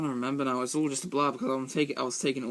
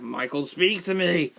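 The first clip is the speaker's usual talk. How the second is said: higher, louder, slowed demand; retold shout; 5 semitones higher, 6 dB louder, slower than usual. Doctor yelling at silent patient in interrogation, loud shout. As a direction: bark the name like losing patience. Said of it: "he starts getting shouty".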